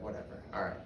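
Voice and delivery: quiet, sullen brush-off; apathetic, angry. Retold or imitated. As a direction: shrug it off under your breath. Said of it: "Just angry all the time".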